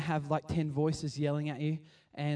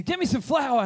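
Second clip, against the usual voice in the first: commanding order; 9 semitones higher, 8 dB louder than usual. Retold or imitated. Elisha ordering the people to bring flour, commanding.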